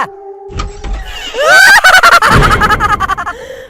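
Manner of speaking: loud high shrieking cackle; loud cackling witch laugh; evil witch cackle. Acted. Mother as witch appears.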